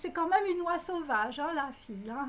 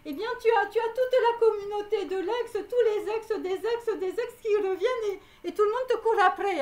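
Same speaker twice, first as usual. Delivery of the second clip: higher and louder, building into laughter; amused, raised-voice warning with a laugh; excited rising laugh; 6 semitones higher, 4 dB louder than usual. Real, not acted. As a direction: get carried away as the exes pile up. Warning about exes coming back.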